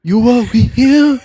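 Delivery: loud, high, slow mock Japanese accent; exaggerated high-pitched Japanese movie accent. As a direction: declaim it haltingly in a hammed-up Japanese accent.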